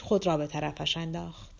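ordinary delivery, nothing marked

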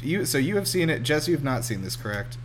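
ordinary talk

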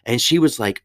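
ordinary talk

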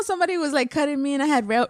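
ordinary talk, nothing marked

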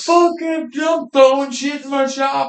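high-pitched retold yelling; excited yell. Retelling reaction in his shop, high excited yelling. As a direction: hype it up, throwing things around.

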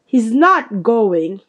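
slow, loud, firm refusal; drawn-out, forceful objection; angry refusal. A narrator's reading. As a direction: put your foot down angrily. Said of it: "looking very angry".

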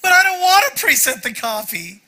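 high, loud whiny complaint; Jerry Seinfeld whine. Retold or imitated. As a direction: whine it like a sitcom star. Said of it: "I whined like Jerry Seinfeld".

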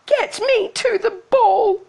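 high, drawn-out petulant shout; stretched, high-pitched bratty yell; shouted demand. A narrator's reading. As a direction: demand it loudly in a high girl's voice.

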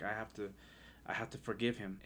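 softly, retelling a tearful moment; quiet, fighting back tears; choked up. Retold or imitated. Telling his wife he must forgive his father, choked up. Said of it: "I'm crying and I can't even talk".

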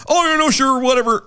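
high, breezy imitation of careless voters; mock naive indifference. Imitating voters who let her slide, mock indifference. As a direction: brush it off in a high, mocking voice. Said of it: "in their naivety or laziness".

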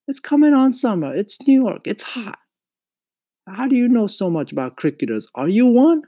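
high, loud scolding mother; scolding mama. A narrator's reading. Mama tells Mario off over crickets, scolding. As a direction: nag at a high pitch, emphatically.

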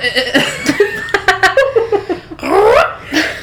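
loud high laugh; burst of laughter. Real, not acted. Laughing before reading.

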